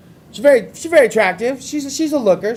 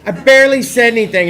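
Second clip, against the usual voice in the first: ordinary delivery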